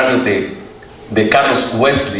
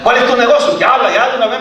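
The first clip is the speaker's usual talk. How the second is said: much higher, impatient demand from the angry judge; 10 semitones higher than usual. Retold or imitated. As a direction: bark it like an irritated judge. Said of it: "Y estaba enojado el hombre". (el juez enojado exige una respuesta, mucho más agudo)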